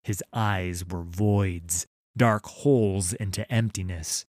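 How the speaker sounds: usual speaking voice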